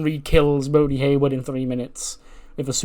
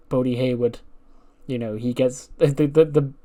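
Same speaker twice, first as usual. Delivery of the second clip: ordinary talk, nothing marked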